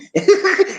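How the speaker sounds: high burst of laughter; real laugh up high. Real, not acted. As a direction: laugh at his own impression. After his impression.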